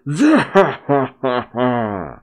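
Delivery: imitating a booming pirate cackle; Blackbeard laugh. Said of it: "You hear Blackbeard laughing".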